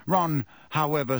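usual speaking voice

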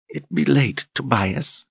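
high, soft intimate coo. Acted. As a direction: coo it seductively. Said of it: "she cooed intimately".

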